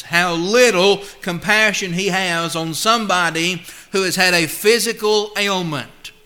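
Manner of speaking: ordinary talk, nothing marked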